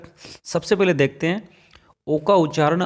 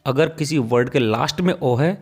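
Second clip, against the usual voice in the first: ordinary delivery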